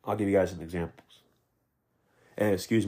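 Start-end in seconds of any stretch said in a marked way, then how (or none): 0.0-1.2 congested, under-the-weather voice; talking through a head cold; sick voice. Real, not acted. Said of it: "I'm sure my voice sounds a little different".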